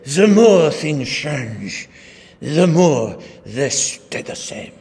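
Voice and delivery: slow, hammed-up French accent; Jean-Baptiste Alphonse Karr French accent. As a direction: drawl it out like an outrageous French philosopher. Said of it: "his outrageous French accent".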